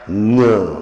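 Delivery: ङ loud, nasal push at the end; loud demo ङ with nasal tail; nasal release. Shown as a demonstration. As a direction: push the air out of the nose on ङ. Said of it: "नासिका को देखो".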